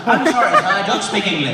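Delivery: loud laughing mock apology; laughing fake apology. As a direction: laugh through a fake excuse. Feigns no English, laughing.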